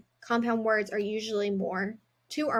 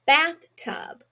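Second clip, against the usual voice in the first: "Bathtub" higher and louder, rising; cheerful reveal; 5 semitones higher, 5 dB louder than usual. Shown as a demonstration. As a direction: announce "Bathtub" brightly to the class. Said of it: "put them together to make a compound word".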